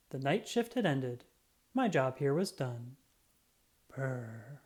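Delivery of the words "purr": soft purr. Acted. Muffin the cat purring in the bear hug, soft. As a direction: purr softly like a happy cat. Cat purrs, job done.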